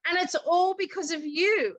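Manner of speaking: high, choked-up retelling of her tears; retold tearful thanks. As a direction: imitate a friend crying with gratitude. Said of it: "she was crying, I was crying".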